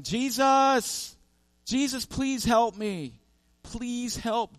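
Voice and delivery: high, loud plea, dragging the words out; desperate yelling. Retold or imitated. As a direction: cry out for help, losing the fight. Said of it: "he's yelling".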